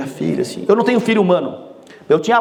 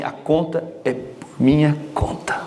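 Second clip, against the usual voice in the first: slow, lower, proud announcement to the parents; proud treat-giver; 4 semitones lower, 4 dB quieter, slower than usual. Pretend. Child proudly paying the parents' dinner bill, slow and low. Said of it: "fala assim".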